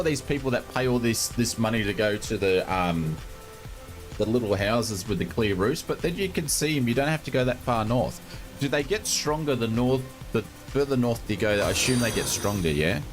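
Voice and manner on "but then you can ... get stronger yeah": ordinary talk, nothing marked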